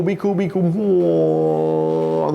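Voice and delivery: slowing right down, last word stretched out; mock fainting. Inmate telling himself to stay calm, woozily slowing. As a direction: trail into a long woozy drone.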